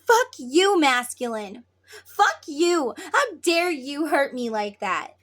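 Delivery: high, loud acted fury; hurt, angry outburst. Feminine lashing out at her masculine, acted hurt anger. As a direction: lash out, wounded and loud. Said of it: "When you say".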